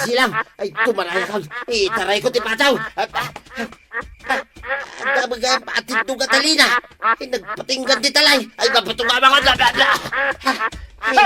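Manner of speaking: flustered thief caught mid-escape, yelling; panicked. Acted. Thief panics, fleeing with duck.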